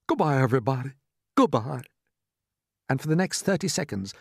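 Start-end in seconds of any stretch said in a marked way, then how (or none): 0.1-1.8 lightly voiced man's farewell; resigned strong man. A narrator's reading. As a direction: say a stoic goodbye, a touch of character. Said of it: "Strong men turned to one another".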